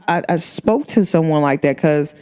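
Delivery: usual speaking voice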